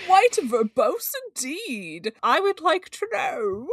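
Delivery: high, slow hammed-up posh voice; mock posh voice. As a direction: play it pompous and slow.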